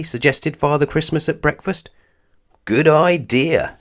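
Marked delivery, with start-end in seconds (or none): 2.7-3.8 slow, high eager agreement; drawn-out keen yes; eagerly. Acted. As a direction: savour the idea eagerly. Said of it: "said Mister Christmas eagerly".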